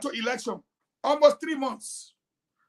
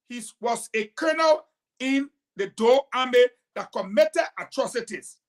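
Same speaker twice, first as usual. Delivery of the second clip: usual speaking voice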